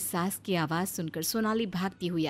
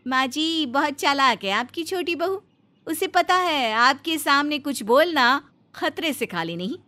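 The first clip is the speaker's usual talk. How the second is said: higher, tattling complaint to mother-in-law; a touch louder, stirring up trouble; scheming elder daughter-in-law; 5 semitones higher, 7 dB louder than usual. Acted. Elder bahu complains to her mother-in-law, higher, tattling.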